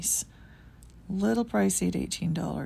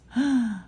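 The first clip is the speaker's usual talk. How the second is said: delighted gasp; 5 semitones higher, 4 dB louder than usual. Real, not acted. Spots more finds.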